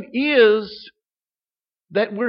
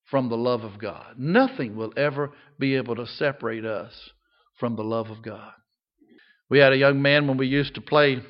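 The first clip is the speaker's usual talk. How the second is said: usual speaking voice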